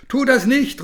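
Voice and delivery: high, loud warning cry; calling out. A narrator's reading. Boy calls to his cousin, loud warning cry. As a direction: shout to stop her. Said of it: "ruft er".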